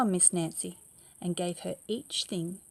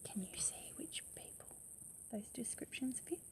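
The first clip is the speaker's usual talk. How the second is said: whisper; 5 semitones higher, 16 dB quieter than usual. Real, not acted. Teacher asks class in whisper.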